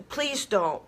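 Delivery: ordinary talk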